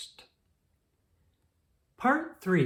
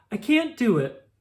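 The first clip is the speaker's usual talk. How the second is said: scared refusal; 6 semitones higher, 5 dB louder than usual. A narrator's reading. Scared child refuses mother.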